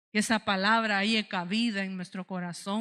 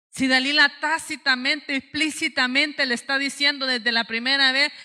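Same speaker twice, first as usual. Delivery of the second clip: raised, loud pulpit voice; emphatic preaching; 4 semitones higher, 5 dB louder than usual. Real, not acted. Preacher exposing Delilah's scheme to the church, emphatic preaching. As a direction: hammer the point to the congregation.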